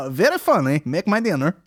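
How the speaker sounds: high, loud mock Russian accent. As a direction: play a Russian joke teller. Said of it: "a Russian accent".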